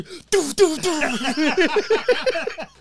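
high, punchy doof sound effects; mock dog attack noises. Imitating the dog attacking the boy, high doof noises. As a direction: make the thumps of a dog lunging.

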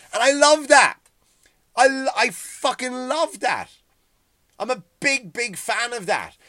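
gleeful burst of enthusiasm; excited. Real, not acted. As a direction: belt out how much you love it. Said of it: "emphatic self statement".

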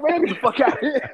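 high, loud yell breaking into laughter; mock angry dad, laughing. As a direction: bark it out while cracking up.

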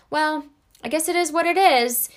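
mock defeated shrug; defeated acceptance. Shown as a demonstration. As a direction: sound like you've given up. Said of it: "almost like accepting defeat".

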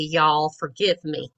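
ordinary talk, nothing marked

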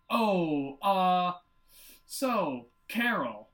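mock-awkward fumbling for an opener; awkward hesitation. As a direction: imitate awkward stalling, halting between words. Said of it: "it's so awkward".

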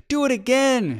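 put-on very high, loud squeal of a delighted little girl; excited cry. A narrator's reading. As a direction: cry out as if jumping for joy. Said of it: "she cried, jumping".

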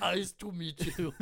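slow, heavy Austrian action-star voice; Schwarzenegger impression. Shown as a demonstration. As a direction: do Arnold again. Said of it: "how to do an Arnold Schwarzenegger dialect".

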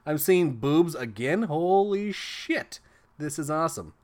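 imitating an awestruck boy, rising to a drawn-out peak; amazed kid. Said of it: "he's like".

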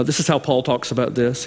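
ordinary delivery

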